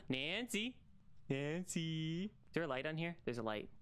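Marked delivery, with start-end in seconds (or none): none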